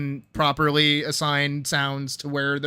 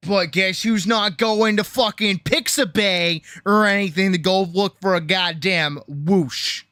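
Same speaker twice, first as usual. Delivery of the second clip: loud rant; 5 semitones higher, 6 dB louder than usual. Real, not acted. Ranting at the sound site.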